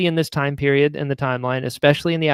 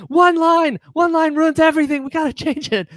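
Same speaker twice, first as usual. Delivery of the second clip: much higher, frantic alarm; panicked self; 12 semitones higher than usual. Retold or imitated. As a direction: squeal it like the sky is falling. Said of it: "I was like".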